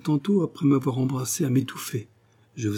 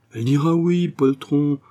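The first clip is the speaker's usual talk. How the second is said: high, louder reassurance; hearty scolding; 5 semitones higher, 5 dB louder than usual. Acted. Father assures the timid suitor, hearty scolding. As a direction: chide the coward cheerfully and emphatically.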